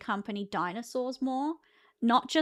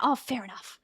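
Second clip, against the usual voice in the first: usual speaking voice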